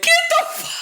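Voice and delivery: very high, loud mock shout with a laugh; retold shout. Woman imitating her boyfriend shouting at her, loud. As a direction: bark it out like he did, laughing.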